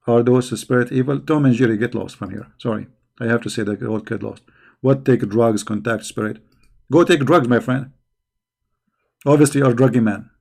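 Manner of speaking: ordinary talk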